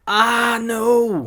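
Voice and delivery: long, high, loud wail of dismay; retold cry of dismay. Parent retelling dropping the phone, loud dismayed wail. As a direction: wail it out, stretching both words. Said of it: "I went".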